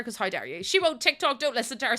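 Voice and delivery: ordinary talk, nothing marked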